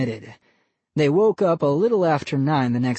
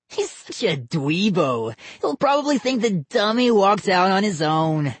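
mocking kid voice; 5 semitones higher than usual. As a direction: sneer at the dweeb. Boy character voice.

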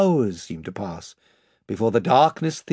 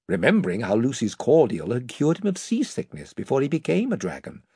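plain reading voice

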